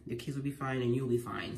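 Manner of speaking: usual speaking voice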